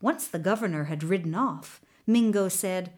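usual speaking voice

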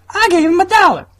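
high, loud mock-wife outburst; exasperated wife. Wife complaining about the dollar, high exasperated voice. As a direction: play the wife shrilling her complaint. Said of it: "And his wife's like".

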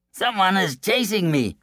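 breathless frightened boaster; scared coward. Acted. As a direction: squeak it out in fright. Coward flees again.

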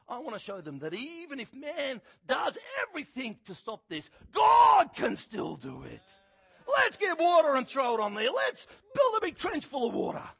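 loud, high, slowed proclamation; fervent shout. Retold or imitated. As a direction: preach it with fire. Elijah's fervent declaration.

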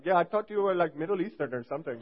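light impression of an Indian accent. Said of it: "I might do an Indian accent here".

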